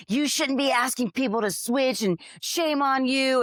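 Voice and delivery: high, slow mocking scold; scolding commenter. TikTok commenters scolding her for asking, mock high. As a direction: mimic a preachy online critic. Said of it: "They're like".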